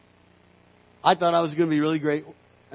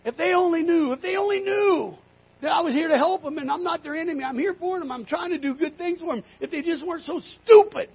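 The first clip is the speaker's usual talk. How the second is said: high-pitched, impassioned complaint, building on the last word; exasperated scream; 12 semitones higher, 4 dB louder than usual. Retold or imitated. Rancher to God about the cows, frustrated screaming. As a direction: cry out in frustration. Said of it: "And I screamed".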